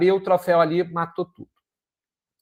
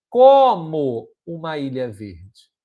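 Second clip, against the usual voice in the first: como uma ilha verde said slowly, with a louder, lower como; stress on como; 3 semitones lower, 5 dB louder, much slower than usual. Shown as a demonstration. Teacher showing students the hidden connective, stressing como. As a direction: lean hard on the word como and draw the phrase out. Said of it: "O como tá escrito?".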